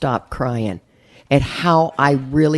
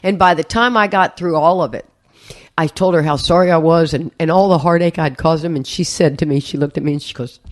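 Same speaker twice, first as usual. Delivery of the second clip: ordinary talk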